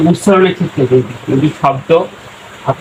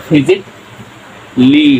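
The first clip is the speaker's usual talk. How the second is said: slow teaching; much slower than usual. Shown as a demonstration. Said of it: "কখনো physically বলতে যাবেন না".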